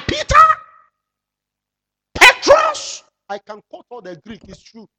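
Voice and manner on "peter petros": loud high mocking call. Real, not acted. Preacher teasing a congregant with the Greek name.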